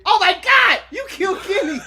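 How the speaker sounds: high, loud, hammed-up outrage; mock scream. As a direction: shriek it in playful horror. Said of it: "you should scream".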